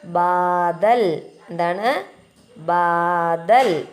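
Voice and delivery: ബാദൽ stretched very slowly with a falling end; model reading. Shown as a demonstration. Teacher reads ബാദൽ aloud for children, very slow. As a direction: draw out ബാദൽ for the class. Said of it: "ചേർത്തെന്ത് പറയും?".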